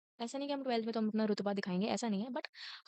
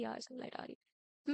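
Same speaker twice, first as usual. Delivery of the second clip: softer, slow squinting grumble; soft complaint; 8 dB quieter than usual. Pretend. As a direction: mutter about the sun in your eyes. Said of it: "आप भी ऐसे करोगे कि".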